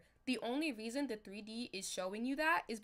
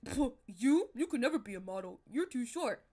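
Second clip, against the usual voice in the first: scoffing put-down, louder, in a put-on voice; mocking Bob; 2 semitones higher, 7 dB louder than usual. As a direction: sneer it at her like a snob. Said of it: "And Bob's like".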